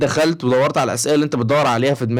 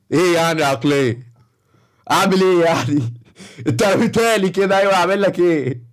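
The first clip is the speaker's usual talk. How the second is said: slow, dim-witted mock voice of a clueless viewer; mocking the commenter; slower than usual. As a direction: play the naive commenter, drawn out and dopey. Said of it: "عامل كده وهو بيتكلم".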